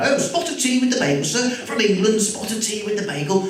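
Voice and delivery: high-pitched New Yorker's bad English accent; mock English accent. Old shop owner teasing an English customer, mock English accent. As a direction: put on a fake English accent, high and chirpy. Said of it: "he impersonates my accent".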